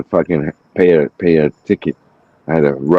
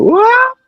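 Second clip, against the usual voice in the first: high-pitched wailing scream, imitated; long, high mock shriek; imitated night-terror scream; 4 dB louder than usual. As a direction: mimic a sudden scream from another room. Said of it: "I start hearing".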